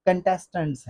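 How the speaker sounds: कंटेस्टेंट्स as kun-TES-tunts, softly; short uh starting कंटेस्टेंट्स; correct pronunciation. Shown as a demonstration. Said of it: "not कॉन्टेस्टेंट्स". (कंटेस्टेंट्स सही, हल्के कन से)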